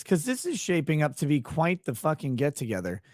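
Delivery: ordinary delivery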